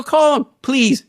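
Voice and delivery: retold defiant sarcasm; sarcastic dare. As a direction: invite him to call, dripping with sarcasm. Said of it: "I was so livid".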